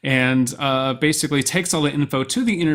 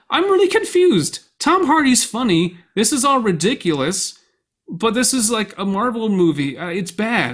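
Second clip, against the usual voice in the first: high-pitched imitation of a confused reviewer; mock baffled critic; 8 semitones higher, 4 dB louder than usual. Film fan mimicking critics' reviews, mock bafflement. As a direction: lightly voice a bewildered critic, pitched up.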